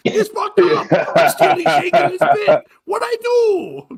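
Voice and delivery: high, loud mock Chinese accent; hammed-up protest in a caricatured Chinese accent. Host owning up to the voice, high mock Chinese accent. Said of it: "for doing voice the entire time".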